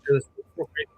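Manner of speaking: muffled, like talking underwater; bad connection, muffled underwater sound. Real, not acted. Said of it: "you sound like you're underwater".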